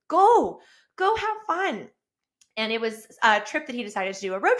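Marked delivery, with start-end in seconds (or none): 0.1-1.9 high, bright push to go; excited urging. Retold or imitated. Wife telling husband to enjoy the trip, excited and high. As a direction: send him off gleefully.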